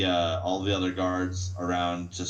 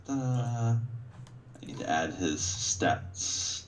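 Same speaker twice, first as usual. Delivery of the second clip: usual speaking voice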